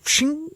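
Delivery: high sharp blade sound; mock knife sound effect; knife shing. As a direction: hiss it like a blade.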